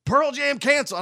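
high excited call, mildly imitated; mock ex-girlfriend calling upstairs with news; a light imitation of an excited shout; retold yell up the stairs. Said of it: "was yelling up the stairs".

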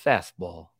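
low, dreamy narrator voice; Morgan Freeman. Acted. Pitcher imagining his pitch called in his ear, dreamy and low. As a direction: drop deep and say it dreamily. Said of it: "that just dreamy Morgan Freeman voice".

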